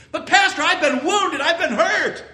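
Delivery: high, loud mock whine with a laugh; high loud mocking wail; loud aggrieved complaint. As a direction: ham up a wounded member's protest. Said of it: "with loud voice, just like that".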